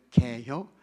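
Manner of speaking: short question with a hint of Chungcheong dialect; punchy Chungcheong-style query. Shown as a demonstration. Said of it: "그걸 충청도 말로 뭐 해요?".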